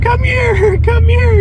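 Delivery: high coaxing dog call with a laugh. Retold or imitated. Handcuffed, coaxing the dog over, high and laughing. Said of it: "I'm like".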